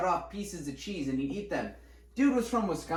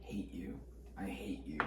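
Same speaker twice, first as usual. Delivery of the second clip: hushed, whispered loathing, imitating a teacher; mock whispered hatred; 8 semitones lower, 13 dB quieter than usual. Teacher to the kid who only reads, mock hateful whisper.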